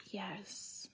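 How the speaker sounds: a retold hushed yes; whispered yes. Wife answering husband in a hushed whisper. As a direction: breathe the yes.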